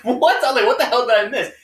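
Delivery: sudden high, loud What?; retelling a stunned, loud what-the-hell; shocked disbelief. Retold or imitated. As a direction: blurt it out, totally caught off guard. Acted shocked reaction to news.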